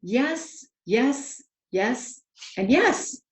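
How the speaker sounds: joyful servant confirming everything; exclaimed. A narrator's reading. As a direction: build each yes a bit brighter. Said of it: "the manservant exclaimed".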